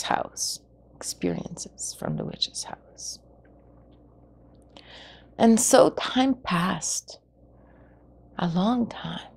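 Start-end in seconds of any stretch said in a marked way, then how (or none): 0.9-3.2 hushed, breathless whisper of the witch's house; eerie storyteller whisper. Shown as a demonstration. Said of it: "repeated as a whisper". 8.4-9.3 soft whispered aside; hushed narrator whisper. A narrator's reading. Narrator whispers passing time.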